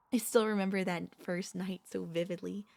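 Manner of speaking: ordinary talk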